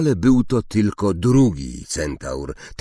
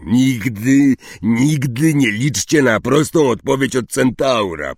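loud, exasperated warning; higher, fed-up grumbling; angry; 6 semitones higher, 6 dB louder than usual. A narrator's reading. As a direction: complain crossly, hammering the repeated word. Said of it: "powiedział ze złością Hagrid".

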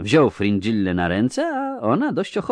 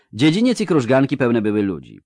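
usual speaking voice

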